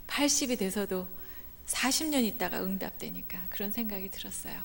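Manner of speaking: soft whisper; hushed wry aside. Real, not acted. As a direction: let it slip as a secret. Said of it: "unvoiced whisper aside".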